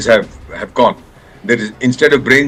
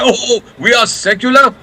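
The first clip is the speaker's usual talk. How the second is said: mock pious; 10 semitones higher than usual. Mocking the politically correct line.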